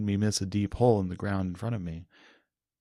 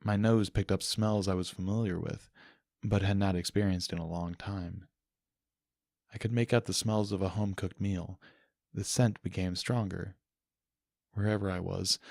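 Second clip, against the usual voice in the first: ordinary delivery, nothing marked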